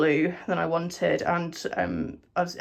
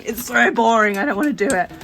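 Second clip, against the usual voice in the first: loud, bored whine; grumbling at raised volume; whiny complaint; 4 semitones higher, 11 dB louder than usual. Real, not acted. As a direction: complain loudly like you dread the chore. Complaining about the painting job.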